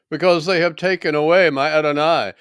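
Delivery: high, loud lament; distressed cry. Acted. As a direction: raise the voice in grieved protest. Weeping woman answers the angels.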